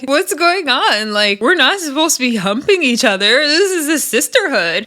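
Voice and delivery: high, mock-appalled scolding; scandalized. Recapper retells Rolly scolding the housemates for hooking up, mock-scandalized. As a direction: imitate someone aghast at the group.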